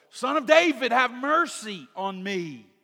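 loud, high, pleading call; crying out. Retold or imitated. Blind beggar calling to Jesus, loud pleading cry. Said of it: "he cried out all the more".

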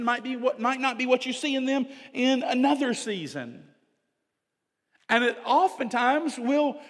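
high, loud pulpit preaching; forceful preaching. Acted. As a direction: preach it with full fire to the congregation.

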